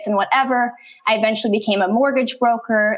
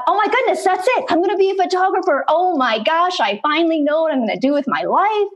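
much higher, thrilled retelling; excited realization; 7 semitones higher than usual. Retold or imitated. Teen recalling finding her calling, excitedly high. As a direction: relive the light-bulb joy. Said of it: "I was so excited".

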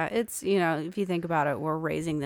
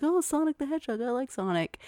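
put-on high, excited little-kid voice; kid's excited voice; 8 semitones higher than usual. Dad imitating his son spotting Sonic, high and excited. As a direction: squeal it like a thrilled toddler. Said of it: "was like".

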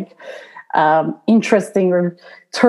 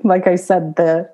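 ordinary delivery

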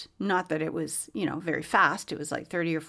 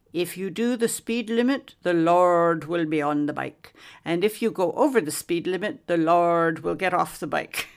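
put-on Welsh accent; 4 dB louder than usual. Retelling a Welshman's motorcycle advice in Welsh accent. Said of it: "he had this really interesting accent".

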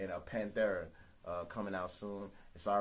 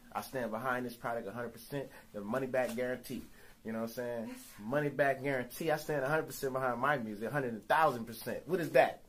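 energy drink ad; 4 semitones higher, 8 dB louder than usual. Acted. Mock product pitch, money back.

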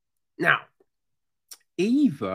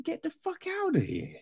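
high, fast, soft brush-off; imitating a dismissive victim; mock dismissal; 11 semitones higher, 6 dB quieter, faster than usual. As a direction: shoo them off in a high voice. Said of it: "they should have just been".

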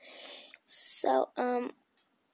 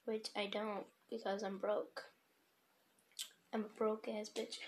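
usual speaking voice

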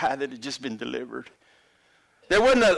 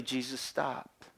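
usual speaking voice

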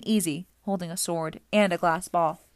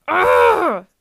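loud, high monster yell; long, high cry after a blow; yell of rage; 12 semitones higher, 13 dB louder than usual. A narrator's reading. Medusa is struck by Grover's branch, yelling out. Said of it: "Medusa yelled".

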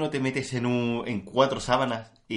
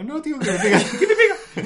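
laughing; 6 dB louder than usual. Retold or imitated. Retold camping scene, laughing.

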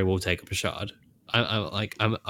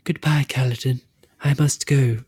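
a higher put-on voice for a tragic goodbye; mock farewell; 5 semitones higher, 4 dB louder than usual. Mock farewell to Kaladin, voiced higher. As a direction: play the noble departing spren, voice lifted. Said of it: "it's like".

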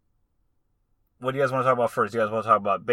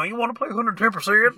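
high goofy Brendan Fraser and Kermit voice; squeaky Kermit-style jock voice; Brendan Fraser mixed with Kermit; 10 semitones higher than usual. Retold or imitated. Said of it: "Mixed with Kermit".

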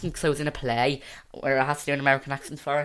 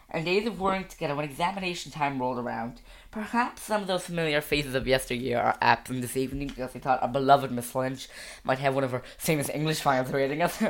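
American graduation speech; put-on American valedictorian accent. Shown as a demonstration. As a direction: recite a school-play speech in an American accent. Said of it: "That was American".

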